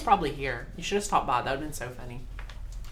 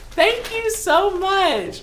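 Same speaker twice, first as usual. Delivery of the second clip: gushing thanks; 12 semitones higher, 3 dB louder, much slower than usual. Real, not acted. Thanking the gift giver.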